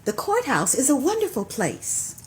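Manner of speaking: rhythmic poem recital with cues; poetry call and response. Acted. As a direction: perform the poem, leaving room for the response.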